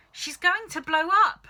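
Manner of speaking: high, loud panicked wail. Acted. Wife wailing that grandma will explode, high and loud. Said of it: "Missus Cranky wailed".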